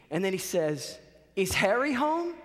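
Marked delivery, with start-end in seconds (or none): 1.4-2.3 high, confused Boston landlord; Boston accent. Retold or imitated. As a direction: ask it in a strong mock Boston accent. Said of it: "this very strong Bostonian accent".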